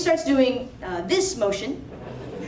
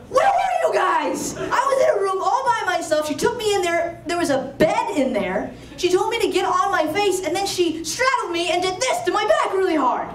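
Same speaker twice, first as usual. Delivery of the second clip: worked-up rant; 7 semitones higher than usual. Retold or imitated. Christina bursting in on her family, high laughing rant. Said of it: "she is going off".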